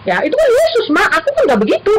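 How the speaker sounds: put-on high, tearful child insisting; crying child. Daughter crying to her mother, high and tearful. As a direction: whimper back that you're not like that.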